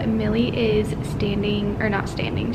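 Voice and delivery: whispering in a library; hushed whisper, barely voiced. Real, not acted. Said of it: "so that's why I am a little bit quieter".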